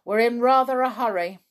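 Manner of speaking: put-on a boy calling back as he hurries on. A narrator's reading. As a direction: keep calling back while walking.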